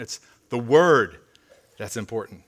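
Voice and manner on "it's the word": "word" drawn out and much louder than "It's the"; stress on WORD. Real, not acted. Preacher correcting what matters in the verse, "word" heavily stressed. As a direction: land slowly and firmly on "word".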